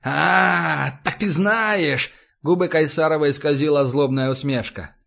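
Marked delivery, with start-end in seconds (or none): 0.0-2.1 spiteful realisation. A narrator's reading. Captive realising his captor knows, slow and spiteful. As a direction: stretch it out with malicious discovery.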